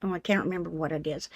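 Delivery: normal talking voice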